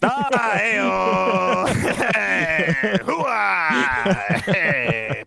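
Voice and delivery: whooping, laughing hey-oh and hoo-ah; laughing cheer. Real, not acted. Cheering the Googled insult joke.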